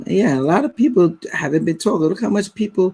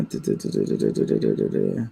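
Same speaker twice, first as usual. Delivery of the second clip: very low rapid da-da-da; idle filler sounds; 5 dB quieter than usual. Real, not acted. Host scanning viewers' replies, idly da-da-ing low. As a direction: murmur a string of low das while searching.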